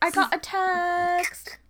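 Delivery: slow, high, drawn-out accented "I got a text"; saying it in her accent. Shown as a demonstration. Friend repeating her catchphrase in her accent, slow and high. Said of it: "I still say it in the accent".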